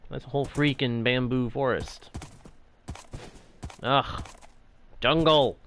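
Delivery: ordinary delivery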